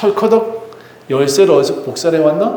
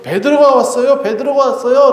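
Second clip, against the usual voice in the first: put-on high, excited child's announcement; a girl bursting to tell the news; 9 semitones higher than usual. Child announcing Peter's arrival. (엄마에게 베드로가 왔다고 신나서 외치는 아이)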